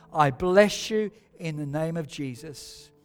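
hushed, fading benediction; blessing. Real, not acted. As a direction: close softly in blessing. Soft closing blessing.

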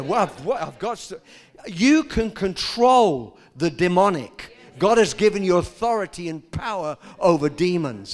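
loud, driving preacher voice; full-force preaching. Acted. Preacher to congregation about authority over demons, loud fervent preaching.

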